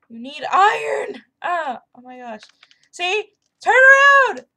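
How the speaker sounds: high, loud frantic yells; exasperated high shouts at a gamer who won't listen; frantic shouting. Real, not acted. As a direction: yell at the teammate in panic.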